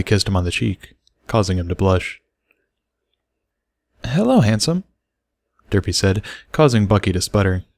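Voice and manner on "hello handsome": high, coy greeting; lightly voiced flirty mare; flirty hello. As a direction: purr it sweetly to him. Derpy flirts with Bucky.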